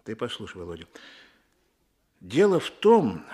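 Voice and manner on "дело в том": emphatic. Acted. Emphatic opener.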